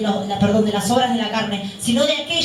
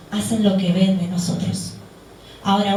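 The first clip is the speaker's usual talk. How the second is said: ordinary talk, nothing marked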